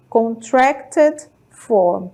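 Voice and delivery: "Contracted form" drawn out slowly, syllable by syllable; slow model pronunciation. Shown as a demonstration. Teacher repeating the term for students, slowly and clearly. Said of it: "contraída".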